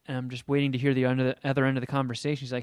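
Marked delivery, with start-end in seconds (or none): none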